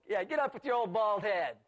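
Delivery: jeering taunt. Acted. Young boys taunting the passing prophet, loud jeer.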